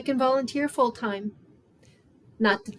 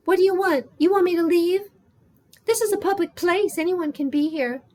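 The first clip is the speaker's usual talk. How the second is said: high, louder, indignant woman's voice; defensive homeless woman; 9 semitones higher, 4 dB louder than usual. Acted. Becky to a stranger who approached her, defensive. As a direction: challenge them in a raised, high pitch.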